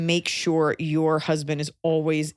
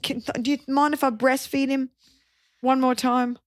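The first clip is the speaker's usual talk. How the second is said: lightly voiced higher-pitched pleading mother; a hint of a pleading mother, pitched up; clingy mother-in-law; 7 semitones higher than usual. Mother-in-law begging to breastfeed her grown son, mock pleading.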